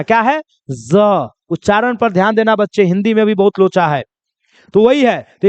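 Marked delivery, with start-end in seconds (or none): 0.7-1.3 "ज" as a buzzed z, falling low; z sound. Shown as a demonstration. Teacher asking students to listen, buzzed z sound. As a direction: buzz "ज" like English z. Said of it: "ध्यान से सुन लेना".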